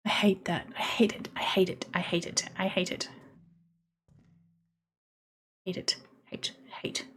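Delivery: very quiet, half-whispered muttering; barely audible nervous chant; hushed dread. Real, not acted. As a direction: repeat it under your breath, rapidly losing voice. Creeped out alone in game.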